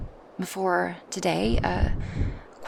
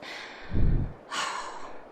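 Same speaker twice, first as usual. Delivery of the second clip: long, airy exhale; long sigh; 3 dB quieter than usual. Acted. Dragoness who just squeezed her passenger, sighing.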